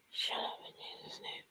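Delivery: barely audible quick whisper; tiny muttered prayer, almost no voice; under the breath. Shown as a demonstration. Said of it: "Say it under your breath".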